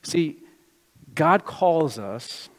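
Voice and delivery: ordinary talk, nothing marked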